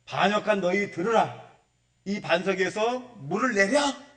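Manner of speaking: acting out Moses scolding the crowd; very displeased tone. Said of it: "아주 기분 나쁜 투로 얘기하는 거예요".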